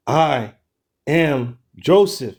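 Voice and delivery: "I am Joseph" slow, every word weighted; with emphasis. Shown as a demonstration. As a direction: lean hard on "am Joseph", drawn out. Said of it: "with emphasis when you get to that point".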